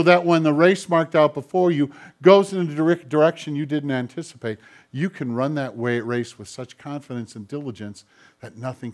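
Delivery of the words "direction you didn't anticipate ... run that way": normal talking voice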